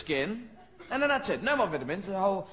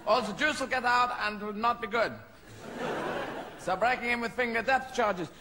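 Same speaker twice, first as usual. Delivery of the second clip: higher German submarine captain voice, half-hammed; a lightly hammed German commander; Jürgen Prochnow; 5 semitones higher than usual. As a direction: bark orders like a U-boat captain, mock German. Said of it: "With Jürgen Prochnow going".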